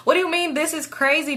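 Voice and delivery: normal talking voice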